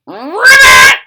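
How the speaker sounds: loud, high croaking ribbit; booming frog ribbit; monster frog croak. Acted. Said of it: "he croaked".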